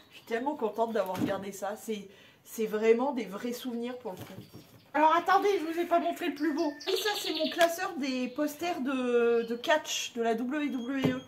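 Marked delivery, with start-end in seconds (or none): none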